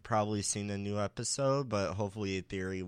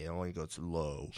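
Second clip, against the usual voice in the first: low voice; 4 semitones lower, 6 dB quieter than usual. Shown as a demonstration. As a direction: drop low and soft. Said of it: "my voice does not go that high".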